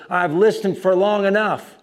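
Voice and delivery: agitated. Retold or imitated. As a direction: imitate a heckler losing patience. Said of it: "he started getting agitated again".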